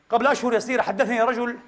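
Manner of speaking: ordinary talk, nothing marked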